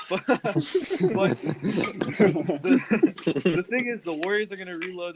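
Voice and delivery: laughing it off after a voice crack. Real, not acted. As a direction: chuckle through the words.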